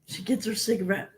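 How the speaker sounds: normal talking voice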